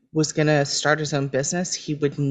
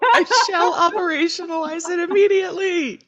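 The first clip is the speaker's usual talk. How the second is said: high, laughing pompous voice; laughing mock formal; 14 semitones higher than usual. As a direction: say it grandly through laughter. Playful mock formal line.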